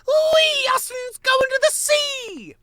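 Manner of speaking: put-on very high, squeaky baby voice, loud and drawn out; a tiny child squealing with delight; excited baby squirrel. Baby squirrel shouting with glee.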